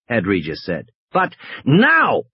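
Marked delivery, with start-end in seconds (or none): 1.1-2.2 annoyed. Acted. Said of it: "Regis was starting to get annoyed".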